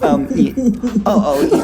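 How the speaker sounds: laughing through words; laughing while trying to answer. Real, not acted. Laughing at the pun.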